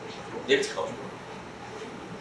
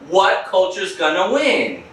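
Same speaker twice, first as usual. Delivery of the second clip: ordinary talk, nothing marked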